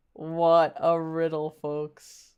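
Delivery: deadpan it like a game show host. Pretend. Repeating the quip to the audience as a low slow mock host.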